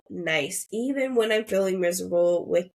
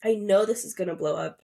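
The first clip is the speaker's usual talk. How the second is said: ordinary talk, nothing marked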